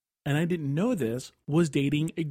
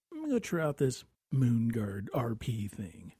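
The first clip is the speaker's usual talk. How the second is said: hushed, lower aside; musing to oneself; 5 semitones lower, 7 dB quieter than usual. Retold or imitated. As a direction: half-whisper a sly little plan. Retold late-night musing to self.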